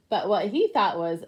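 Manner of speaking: plain reading voice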